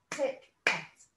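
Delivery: "tick, et" with a clear gap between the beats; split syllables. Shown as a demonstration. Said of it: "Let's split the syllables up".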